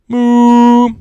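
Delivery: loud, high drawn-out moo; cow moo. Acted. Dad voicing the cow in the story, loud drawn-out moo. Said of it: "says the cow".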